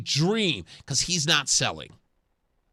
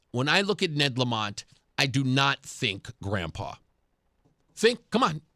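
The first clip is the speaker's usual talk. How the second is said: ordinary talk, nothing marked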